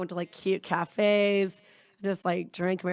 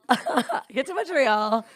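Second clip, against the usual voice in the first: higher, slow, through leftover laughter; laughing; 6 semitones higher, 4 dB louder, slower than usual. Real, not acted. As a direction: pick the story back up while laughing. Resumes story while still laughing.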